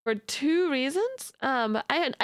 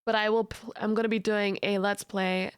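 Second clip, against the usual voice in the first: ordinary talk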